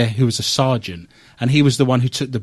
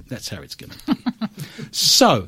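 normal talking voice

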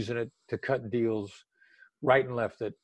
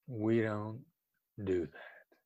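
late night FM DJ voice; 2 semitones lower, 8 dB quieter, much slower than usual. Shown as a demonstration. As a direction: settle "We don't do that." down gently, much quieter. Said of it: "the late night F M D J voice".

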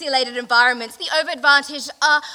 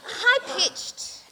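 said up high in a demo of the voice; a light, high-pitched demo; 9 semitones higher than usual. Shown as a demonstration.

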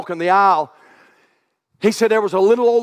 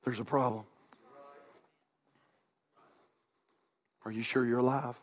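much softer and lower; hushed solemn challenge; 7 semitones lower, 16 dB quieter than usual. Acted. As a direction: drop to a near-hush to confront them.

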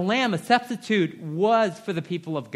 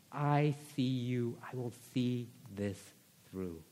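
tender God voice; 4 semitones lower, 9 dB quieter than usual. Acted. God answering the brokenhearted.

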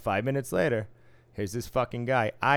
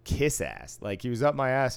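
ordinary talk, nothing marked

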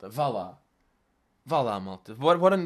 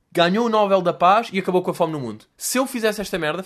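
louder, higher outraged mockery; loud sarcastic rant; 5 semitones higher, 8 dB louder than usual. Real, not acted. Vlogger mocks the post's length: loud sarcastic rant. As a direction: rant in disbelief at the post. Ranting about the long post.

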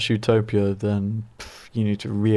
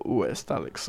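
mock woman's hush; 3 semitones lower, 10 dB quieter, slower than usual. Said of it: "Woman's voice".

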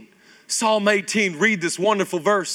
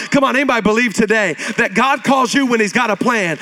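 usual speaking voice

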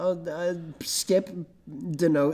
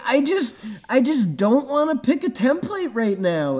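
whiny; 10 semitones higher, 3 dB louder than usual. Retold or imitated. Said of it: "so then I'm like".

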